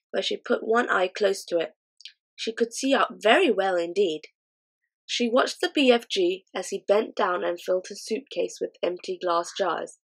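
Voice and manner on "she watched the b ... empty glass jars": ordinary delivery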